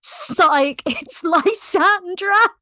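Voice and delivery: spring the trick and giggle; laughing reveal. Retold or imitated. Shapeshifter revealing the trick to the captain, giggling. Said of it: "And Rolf's like".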